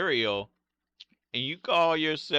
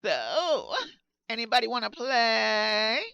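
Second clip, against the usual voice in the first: high put-on voice; 10 semitones higher, slower than usual. Lonely stream call.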